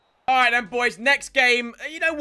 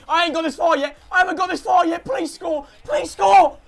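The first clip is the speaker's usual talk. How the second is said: high, loud begging; desperate pleading; 6 semitones higher, 6 dB louder than usual. Real, not acted. Gamer who never got this far, desperate loud pleading. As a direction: beg louder and higher to the last word. Begging to score, furthest run yet.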